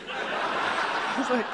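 breathless laugh, slow; real laughter breaking through. Real, not acted. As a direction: crack up mid-line. Teller cracks up at memory.

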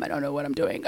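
a whispered confession. Real, not acted. Comic admitting confusion to audience, whisper. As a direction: breathe it out, whispered.

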